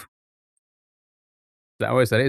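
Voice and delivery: ordinary talk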